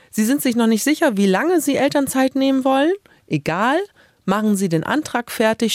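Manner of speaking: normal talking voice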